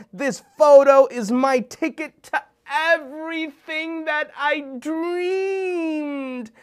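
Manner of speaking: high, loud, stretched out ending; dreamy excitement. Acted. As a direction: savor the dream aloud. Overflows with excitement.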